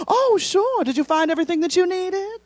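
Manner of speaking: high, sugary Southern hospitality; Southern concierge. Retold or imitated. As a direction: imitate an eager-to-help Southern hostess. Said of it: "with a genuine Southern smile".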